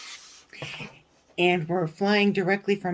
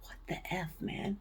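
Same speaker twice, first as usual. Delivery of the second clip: under-the-breath whisper; whispered curse; 14 dB quieter than usual. Real, not acted. Cursing under her breath at customs, whispered. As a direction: hiss the curse so nobody hears. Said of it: "muttered curse aside".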